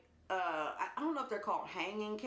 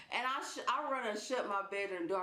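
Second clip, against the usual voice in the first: ordinary delivery, nothing marked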